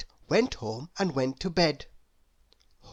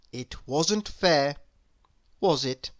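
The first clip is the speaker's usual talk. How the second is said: usual speaking voice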